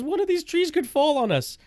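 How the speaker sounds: high-pitched mock panic; imitating panicked Natalie. Passenger fearing trees on a wooded lane, mock panicked. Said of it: "Natalie was freaking out".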